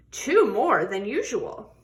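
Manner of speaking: plain reading voice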